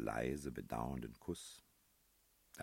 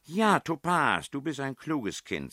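high, loud, drawn-out exclamation; delighted praise; 13 semitones higher, 11 dB louder than usual. A narrator's reading. Princess praising whoever solved the clue, high loud delight. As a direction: cry out approval, stretched and pitched high.

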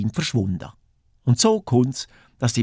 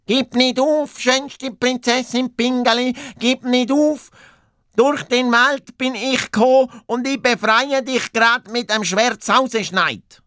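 high-pitched hare calling to the princess; heroic calling out; 13 semitones higher than usual. A narrator's reading. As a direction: call out encouragement in a high hero's voice. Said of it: "Und der Doppelhas ruft". (hoch gerufene Heldenworte des Hasen)

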